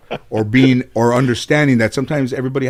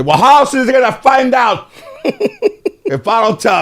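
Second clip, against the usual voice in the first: high laughing grandmother voice, louder; imitating grandma, much higher with a laugh; 5 dB louder than usual. Grandson mimics his grandmother's blunt reply, high and laughing. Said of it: "my grandmother would say".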